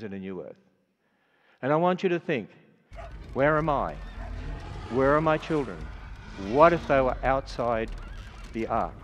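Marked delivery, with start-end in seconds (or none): none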